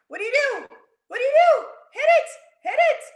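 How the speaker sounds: high loud urgent cry; teacher urging kids to hit pause; urgent shout. A narrator's reading. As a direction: yell it like an emergency. Story climax urging pause button.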